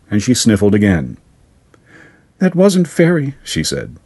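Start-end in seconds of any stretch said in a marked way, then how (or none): 2.4-3.4 put-on high girl's voice, sniffly; a small girl close to tears; girl realizing the truth, tearful; tearful little girl. A narrator's reading. Caroline voiced, after sniffling.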